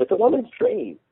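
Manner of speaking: high, strained woman's voice; pleading mother. A narrator's reading. Mother defending herself to her husband, high-pitched pleading. Emma Jane pleading in novel reading.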